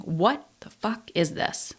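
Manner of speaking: quiet, slow, dogged "what the fuck is this"; determined. Retold or imitated. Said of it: "now I'm determined".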